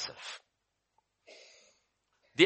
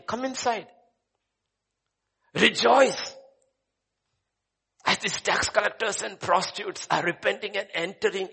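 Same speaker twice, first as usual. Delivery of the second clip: higher, lightly voiced welcoming plea; inviting Jesus; 6 semitones higher than usual. Jesus invites the Pharisees inside, a light welcoming plea. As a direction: call them in warmly.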